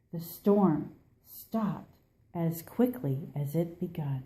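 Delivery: usual speaking voice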